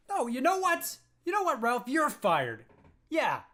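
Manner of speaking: usual speaking voice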